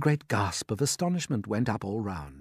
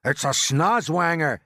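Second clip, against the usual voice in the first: loud, slow, raised-pitch exclamation; alarmed cry; 5 semitones higher, 5 dB louder, slower than usual. A narrator's reading. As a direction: cry out the monster's name. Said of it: "cried the chief of police".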